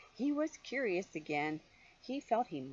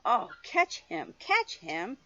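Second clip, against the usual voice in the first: high, louder, slower cry; 5 semitones higher, 5 dB louder, slower than usual. Acted. Firemen crying out to catch George, high and loud. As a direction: call out to catch the monkey. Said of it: "they cried".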